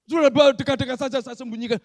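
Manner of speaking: high, loud, blunt scolding angel; angel's rebuke. Retold or imitated. Pastor voices an angel scolding Elia, loud and high. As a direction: bellow it as a stern angel.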